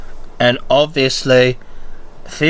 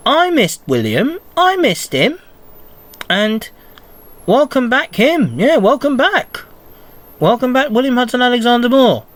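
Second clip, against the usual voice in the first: much higher, gushing welcome back; excited welcome; 9 semitones higher than usual. Real, not acted. Fan welcoming William back, high and eager. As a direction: cheer the returning wrestler home.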